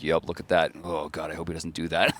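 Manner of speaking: mock flat-voiced dread; monotone voice. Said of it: "in his weird little monotone voice".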